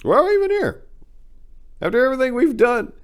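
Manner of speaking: rising to high, loud despairing cries; hefty man crying out to his friend, despairing shout; tearful outburst. Acted. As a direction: break out in despair. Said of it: "was his final exclamation".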